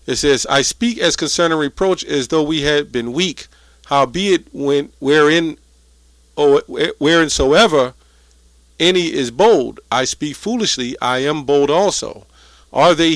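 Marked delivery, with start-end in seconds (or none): none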